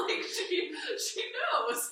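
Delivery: high giggly laughter through the words; laughing. Real, not acted. Student teacher recalls her mentor's praise, laughing high. Moved while telling about praise.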